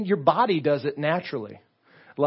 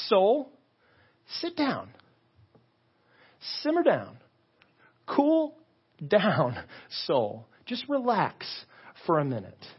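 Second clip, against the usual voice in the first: preaching to himself; 4 semitones higher than usual. Acted. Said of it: "He preaches to himself".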